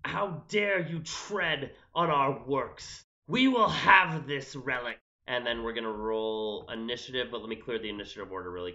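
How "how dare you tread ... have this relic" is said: slow, menacing game villain; deliberate, imposing challenge; indignant villain. Acted. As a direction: declaim it slowly as an affronted enemy. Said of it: "And he says".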